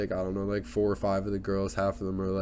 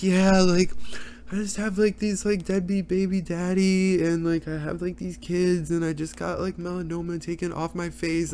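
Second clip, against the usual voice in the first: high-pitched mock reality-star chatter; Khloe Kardashian; 8 semitones higher than usual. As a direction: complain to a friend in a put-on high voice. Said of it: "she just over here, like, with her friend".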